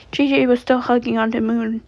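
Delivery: ordinary delivery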